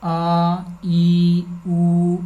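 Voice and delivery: आ, ई, उ called out loud and long; loud drawn vowels. Shown as a demonstration. Teacher loudly demonstrating long vowels to class. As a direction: belt out each long vowel आ, ई, उ. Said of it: "जैसे".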